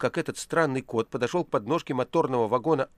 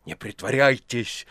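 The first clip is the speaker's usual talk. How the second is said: menacing warning; 4 dB louder, slower than usual. A narrator's reading. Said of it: "грозно сказал Иван".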